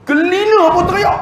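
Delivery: very high-pitched punchline; comic exaggeration. Real, not acted. Preacher telling who wept at farewell, comic high-pitched emphasis. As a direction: squeal it out for emphasis. Comic climax: even the cleaner cried.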